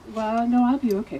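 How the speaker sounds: mock insistent "don't fuss" tone; brushing off concern. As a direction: imitate someone waving away the worry. Retold friend brushing off concern.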